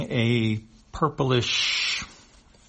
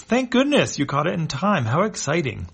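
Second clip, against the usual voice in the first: cheery relief; 7 semitones higher, 4 dB louder than usual. Acted. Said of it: "Stangor, smiling".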